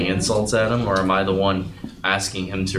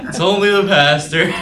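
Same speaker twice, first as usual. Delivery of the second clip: high, giggling joke; laughing; 12 semitones higher than usual. Real, not acted. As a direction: laugh through the line at the joke. Joking about the pastor caller.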